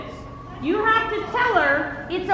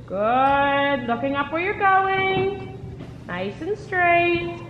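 slow, drawn-out arena calls; coach calling out; much slower than usual. Real, not acted. Riding coach guiding a rider over poles, calling out. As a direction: stretch each instruction out across the arena.

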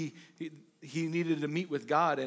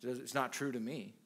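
low, soft mocking of the doubter; lightly mocking disbelief; mock dismissive skeptic; 6 semitones lower, 9 dB quieter than usual. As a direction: mutter it like a shrugging skeptic.

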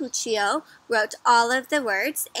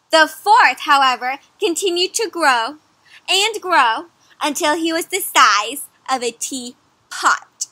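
louder, slow, building narration; dramatic story reading; 4 semitones higher, 8 dB louder, slower than usual. A narrator's reading. Teacher reading how the puppy keeps growing, building loudly. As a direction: make it grow with the words.

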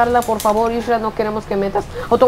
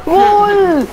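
long, high-pitched goal yell; shouted goal call; 9 semitones higher, 4 dB louder than usual. Real, not acted. Player reacting as the ball goes in, long high shout. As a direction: holler it and stretch the vowel.

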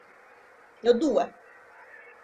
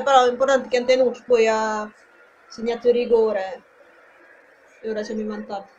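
ordinary talk, nothing marked

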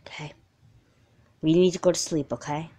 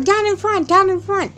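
mock fan yell; 13 semitones higher, 5 dB louder than usual. Mom retelling a yelling fan.

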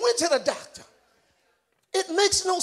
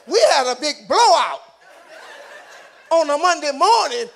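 loud, punched sermon delivery; full-force preaching; 3 semitones higher, 5 dB louder than usual. Real, not acted. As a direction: preach it loud, driving each phrase home.

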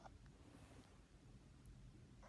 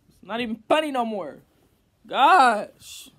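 exasperated; 3 semitones higher, 17 dB louder than usual. Real, not acted. Frustrated after failed prank.

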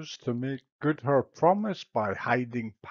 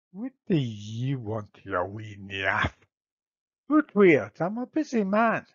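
a gruff Scottish lord brushing off a guest. Acted. Menelaus in Scots.

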